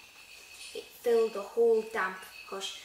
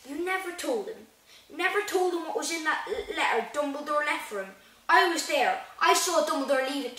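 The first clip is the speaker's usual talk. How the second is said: high, increasingly loud accusation; accusing outrage; 6 semitones higher, 7 dB louder than usual. Acted. Hagrid accusing Dursley of hiding the letter, outraged. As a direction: accuse him, building in indignation.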